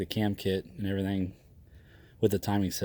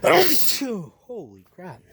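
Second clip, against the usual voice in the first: higher, louder and very slow, drawn out; shocked; 11 semitones higher, 7 dB louder, much slower than usual. Real, not acted. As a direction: stretch it out in stunned disbelief. Shocked by the low offer.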